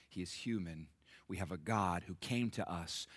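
ordinary talk

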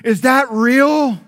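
lightly voiced little boy; imitating young son. Pastor imitating his young sons after the show, mild child voice. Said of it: "they would go".

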